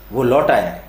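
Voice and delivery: ordinary delivery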